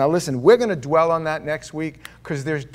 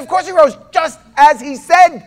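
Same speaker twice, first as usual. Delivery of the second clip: high, loud, certain exclamation; Mary Magdalene insisting he rose; Mary's joyful certainty; 12 semitones higher, 13 dB louder than usual. Retold or imitated. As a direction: voice Mary sure of the resurrection. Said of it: "Mary Magdalene said".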